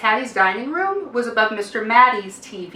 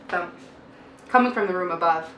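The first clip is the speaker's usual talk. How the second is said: ordinary delivery, nothing marked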